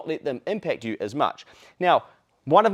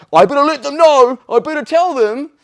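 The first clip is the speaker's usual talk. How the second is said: much higher and louder jealous yell; mock yell; 11 semitones higher, 12 dB louder than usual. Envious commenter rushing to post, hammed-up loud yell. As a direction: bellow it like an envious commenter losing it. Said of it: "people are like".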